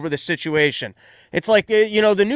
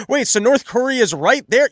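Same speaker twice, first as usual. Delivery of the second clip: high, louder mock surprise; mock shocked question; 6 semitones higher, 4 dB louder than usual. Host mocking Kamala Harris at the border, amazed. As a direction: gasp like it's news. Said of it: "She goes".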